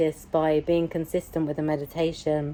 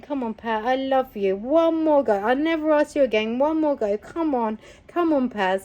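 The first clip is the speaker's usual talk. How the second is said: higher, coaxing sweet voice; little sweet voice; 7 semitones higher than usual. Acted. Her inner voice urging Pat on, sweet. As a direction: gently egg her on, sweetly. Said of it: "this little sweet voice going".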